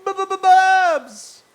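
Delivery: long, high stuttered yell; stuttered chat greeting. Retold or imitated. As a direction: holler it slowly, stretching the last syllable. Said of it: "They go".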